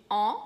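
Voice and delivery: "on" as the French nasal vowel of A N. Shown as a demonstration. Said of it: "de novo o A N". ("on" nasal, som francês de A N)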